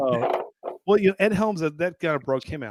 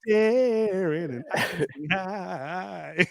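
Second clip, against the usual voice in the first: movie character impression; 7 semitones higher, 5 dB louder, much slower than usual. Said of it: "the guy who played his brother".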